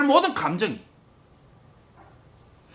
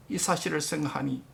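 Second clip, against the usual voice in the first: normal talking voice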